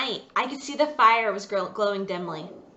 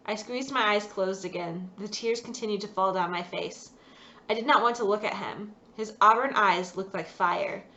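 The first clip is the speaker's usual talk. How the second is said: ordinary delivery, nothing marked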